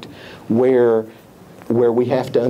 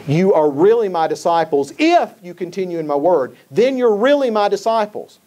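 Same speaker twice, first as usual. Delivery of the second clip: higher-pitched, emphatic quote hitting "really" and "if"; preacher driving home "really my disciples", pitch raised; 6 semitones higher than usual. Retold or imitated. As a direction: punch "really" and lift "if" high. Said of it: "He's being very emphatic there".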